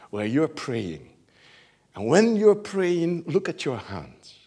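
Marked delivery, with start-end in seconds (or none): none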